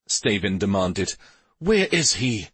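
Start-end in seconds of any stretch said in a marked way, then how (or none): 1.6-2.5 higher-pitched, moderately angry question; angry demand. A narrator's reading. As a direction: demand an answer with a touch of anger. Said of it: "in an angry tone".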